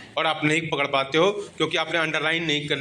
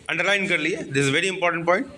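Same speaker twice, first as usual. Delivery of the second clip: ordinary talk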